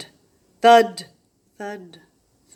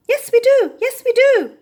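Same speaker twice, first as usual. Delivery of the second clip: much higher, louder shrieking birds; squawked; 12 dB louder than usual. A narrator's reading. Rainbow birds squawk their agreement, loud and high. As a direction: shriek it out in chorus. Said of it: "squawked the rainbow birds".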